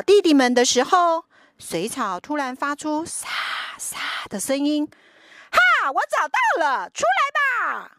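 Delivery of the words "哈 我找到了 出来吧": much higher gleeful shout; excited high shout. Acted. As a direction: shout it out in triumph. Brother finds them.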